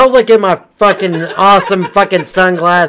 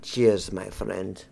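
much lower, far softer voice of Russian Ivan; 11 semitones lower, 14 dB quieter, slower than usual. Pretend. Ivan answers the American patriot, low and quiet. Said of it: "Ivan, come here".

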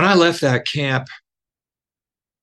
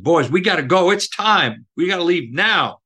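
calling out; 7 semitones higher than usual. Retold or imitated. Counselor calls boys to leave.

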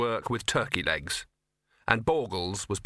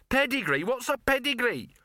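scoffing; 12 semitones higher than usual. A narrator's reading. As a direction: toss the word back in outrage. Gaspode scoffs, pitch jumps high.

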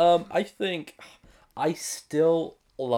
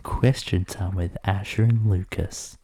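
announcer voice; 4 semitones lower, 4 dB louder, slower than usual. Pretend. Show announces its question segment.

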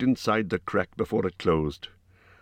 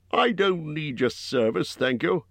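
gruff Bjorn; 9 semitones higher than usual. A narrator's reading. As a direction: voice Bjorn as a blunt giant host. Bjorn voiced by narrator.

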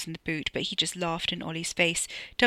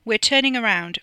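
loud, higher, assured voice; breezy, commanding certainty; confident announcement; 5 semitones higher, 8 dB louder than usual. A narrator's reading. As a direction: declare it with full confidence. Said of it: "Freddie said confidently".

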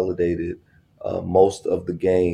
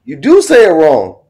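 emphatic insistence; 9 semitones higher, 9 dB louder than usual. Real, not acted. Teasing argument over pronunciation.

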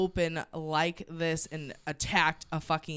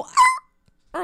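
sudden high, loud yelp; imitated dog yelp; 11 dB louder than usual. Listener mimicking the dog grabbed by an owl, high yelp. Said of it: "but the dog didn't go".

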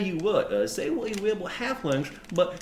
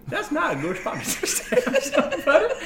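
high protest breaking into laughter; laughing; 10 semitones higher, 3 dB louder than usual. Real, not acted. Ma'am objecting to stabbing feet, laughing. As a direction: crack up halfway through the objection.

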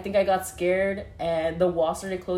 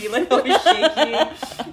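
high, slow, laughing through it; real laughter, slow; 8 semitones higher, 7 dB louder, slower than usual. Real, not acted. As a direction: laugh at how scared she was. Laughing retelling her fear.

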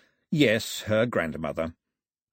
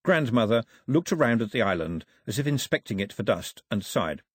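ordinary delivery, nothing marked

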